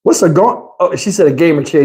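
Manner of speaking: ordinary talk, nothing marked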